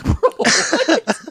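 high, loud disbelieving squeak; can't believe what he just heard; incredulous. Retold or imitated. Podcast host reacting to an absurd clip, high incredulous squeak. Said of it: "I'm like".

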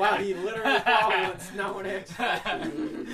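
loud, high, through real laughter; helpless laughing disbelief. Real, not acted. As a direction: crack up while talking. Laughing at friend's single snack.

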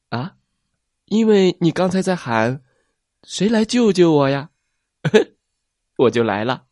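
innocently explain, then laugh; chirpy plesiosaur. Acted. Plesiosaur telling T-rex she heard his cry, giggling.

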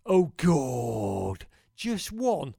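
loud rueful groan; all caps. Retold or imitated. Quoting the friend's all-caps text, loud rueful cry. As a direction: shout it like a defeated fan. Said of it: "all caps".

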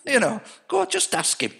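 lightly put-on Geordie accent, pitched high; a rough try at a Newcastle voice; Geordie accent attempt. Mates from the Northeast urging their friend, in a Geordie accent. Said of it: "I can't do the accent".

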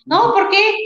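high, startled no, why; quick mock-surprised retort; surprised reply. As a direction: sound caught off guard. Producer surprised by her question.

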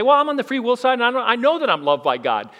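smug objector. Pretend. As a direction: play the self-assured free will believer. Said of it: "You may say".

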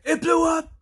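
very high, loud mock yell; excited echo. Henry echoes news of the explosion, imitated as an excited yell. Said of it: "Henry gets excited by what's happening".

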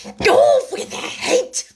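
high, drawn-out screamed command; furious scream. A narrator's reading. The Queen orders Alice's execution, screaming with fury.

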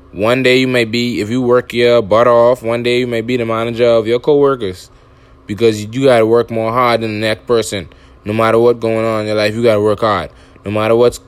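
ordinary delivery

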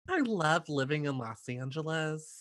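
high, soft, laughing socialite act; cocktail hostess improv. Retold or imitated. Contestant improvising at a party with her cocktail, hammed-up high. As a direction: put on an airy party-hostess voice. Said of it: "her with her cocktail".

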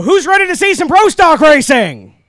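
loud, rising crowd hype call; hype shout. Real, not acted. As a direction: whip up the grandstand. Announcer hyping the crowd.

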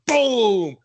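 slow, loud, high impact sound; loud boom. Retold or imitated. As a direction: slam the word like a body check. Acting out a big hit.

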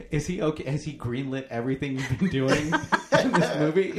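high, loud disbelieving questions; mock incredulity. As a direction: ask it in laughing disbelief. Incredulous viewer reaction.

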